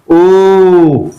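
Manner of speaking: O held long as "ooo" as in moon; long vowel. Shown as a demonstration. Teacher introducing the second word group, long O. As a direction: stretch the O out. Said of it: "long vowel sound".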